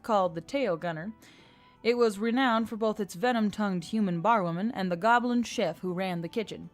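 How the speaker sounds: ordinary delivery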